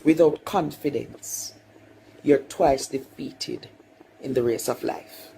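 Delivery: solemn quote. Retold or imitated. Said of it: "what Marcus Garvey said".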